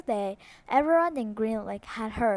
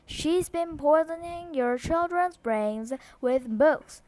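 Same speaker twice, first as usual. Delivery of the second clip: raised, high accusing declaration; declaring; 6 semitones higher than usual. A narrator's reading. Trot declaring to the mob, raised voice. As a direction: proclaim it to the mob. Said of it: "Trot declared".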